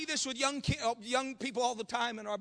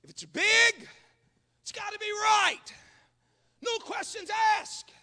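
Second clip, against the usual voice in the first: raised pitch, stretched slogan phrases; mock dogma; 10 semitones higher, 4 dB louder than usual. Preacher mimics America's cult of bigness, mockingly. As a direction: parrot it like an unquestioned creed.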